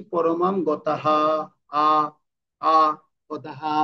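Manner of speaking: গতাহা with the final "haa" fully sounded and held; full, long ending. Shown as a demonstration. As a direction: lengthen the last আ of গতাহা. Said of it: "বড় করে বলছি না?". (গতাহা শেষে বড় করে পূর্ণ হ)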